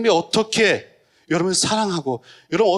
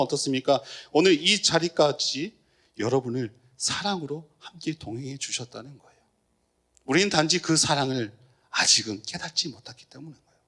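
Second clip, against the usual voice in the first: usual speaking voice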